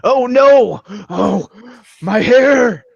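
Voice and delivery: high, loud hammed-up lament; mock anguished wail. Player lamenting his character's singed hair, mock anguished wail. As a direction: wail in exaggerated dismay.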